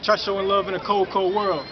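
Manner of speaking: ordinary delivery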